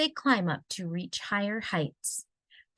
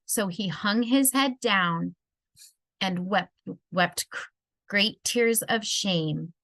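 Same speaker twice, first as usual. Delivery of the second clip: plain reading voice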